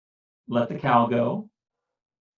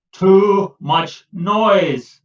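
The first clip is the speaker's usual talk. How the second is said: very slow, louder fed-up cry; Peter fed up; 6 semitones higher, 7 dB louder, much slower than usual. Acted. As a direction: drag out each word in frustration. Peter complains.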